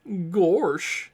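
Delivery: high, loud Southern country gal; southern accent. Acted. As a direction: belt it out like a bubbly Southern gal. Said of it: "that southern accent".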